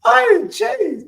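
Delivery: high shout through laughter; laughing shout. Real, not acted. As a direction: yell at him mid-laugh.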